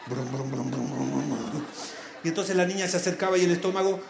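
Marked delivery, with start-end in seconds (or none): 0.1-1.6 low rolled-lip rumbling; belly rumble. Acted. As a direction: rumble like a nervous stomach. Stomach rumbling sound. (gruñido de tripas grave)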